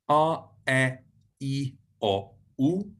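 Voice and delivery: "R, E, I, O, U" as ah, eh, ee, o, oo; five separate vowel sounds, "R, E, I, O, U" said ah, eh, ee, o, oo; vowel recital. Shown as a demonstration. Teacher recapping Hebrew vowels, each sound isolated: ah, eh, ee, o, oo. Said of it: "is how they're pronounced".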